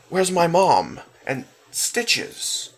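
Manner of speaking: put-on angry boy asking the nurse; asked angrily. A narrator's reading. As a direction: demand an answer angrily. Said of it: "Alex asked angrily".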